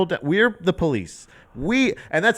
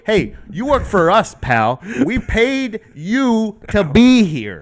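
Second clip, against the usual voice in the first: loud, higher mock rant; yelling; 5 semitones higher, 6 dB louder, slower than usual. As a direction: tell off the band like an irate punter. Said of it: "Pat's just yelling at".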